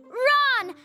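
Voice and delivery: retold yell. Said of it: "And you yelled".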